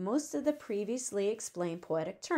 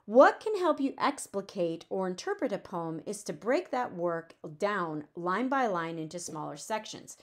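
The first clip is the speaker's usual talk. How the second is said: usual speaking voice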